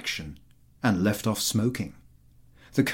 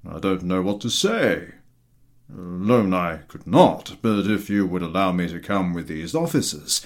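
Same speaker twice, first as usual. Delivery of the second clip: gruff general; 3 semitones lower, 4 dB louder than usual. A narrator's reading. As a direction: grumble it out like an old general, lower. General hesitates.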